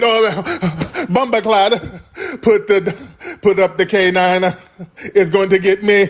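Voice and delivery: lightly high mock Jamaican accent; a hint of a scared Jamaican yelp; mock Jamaican fright. Imitating a Jamaican who sees your dog, mild mock fright.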